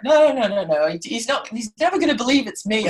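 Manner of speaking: a hint of Paul panicking; nervous refusal.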